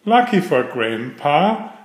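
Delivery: usual speaking voice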